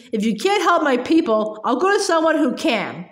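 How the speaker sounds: ordinary delivery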